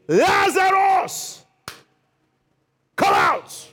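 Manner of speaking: long high "Lazarus", then a hard command; full-force call to raise the dead; commanding shout. Retold or imitated. Jesus calls Lazarus from the grave, commanding shout. Acts Jesus calling into the tomb.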